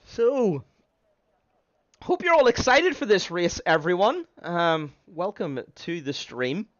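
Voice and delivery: stream host voice. Acted. Opens stream to viewers.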